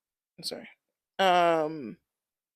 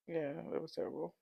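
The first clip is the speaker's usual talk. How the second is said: softer, sighing and hushed; 5 semitones lower, 20 dB quieter, faster than usual. Real, not acted. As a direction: let it out on a sigh, barely voiced. Sighing, sad about scene.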